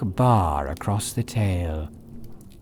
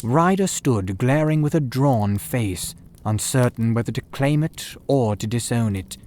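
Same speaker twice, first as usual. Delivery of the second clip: ordinary delivery, nothing marked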